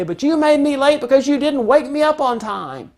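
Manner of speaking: put-on high, loud teen protest; teen blaming parent for not waking him, high and loud; accusing teen. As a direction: push back like an aggrieved kid. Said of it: "And if they say".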